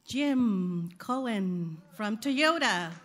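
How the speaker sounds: loud, slow announcement of a name; calling out a name. Real, not acted. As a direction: call the name out across the room. Calling out a raffle winner.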